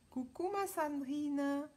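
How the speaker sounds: high, drawn-out hello to a friend; singsong greeting. Real, not acted. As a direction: call out a long, lilting greeting. Greeting a viewer joining the live.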